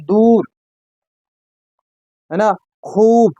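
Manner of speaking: दूर with a full, open ऊ; open long oo. Shown as a demonstration. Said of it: "वाव पर जोर देते हैं हम". (दूर में ऊ को खुल कर पढ़ें)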